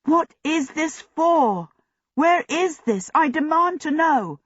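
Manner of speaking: high, indignant questions. A narrator's reading. Chained prisoner challenging his captors, high indignant protest. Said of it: "He protested even more".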